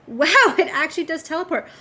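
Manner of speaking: high, loud, delighted wonder; amazed. Real, not acted. Player watching the drink teleport, amazed exclamation.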